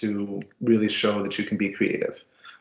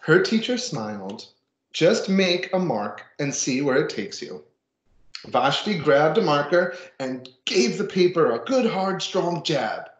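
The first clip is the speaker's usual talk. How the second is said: put-on reading to children; 5 semitones higher than usual. Reads picture book to class.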